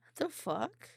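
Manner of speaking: usual speaking voice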